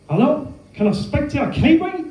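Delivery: mock New Zealand accent, pitched well up; a caller's hammed-up New Zealand accent; bad Kiwi accent attempt. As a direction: put on a clumsy Kiwi accent, high and eager. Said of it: "that attempt at an accent".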